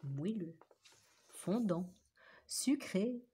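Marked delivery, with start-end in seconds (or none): none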